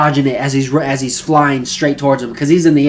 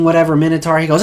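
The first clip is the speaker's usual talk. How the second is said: ordinary delivery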